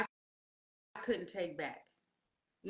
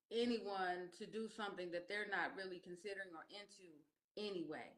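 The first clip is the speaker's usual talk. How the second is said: soft; 9 dB quieter than usual. Real, not acted. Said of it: "sudden soft earnest answer".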